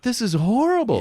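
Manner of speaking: high disgusted remark; appalled. Retold or imitated. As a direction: react in horror. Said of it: "you're just like".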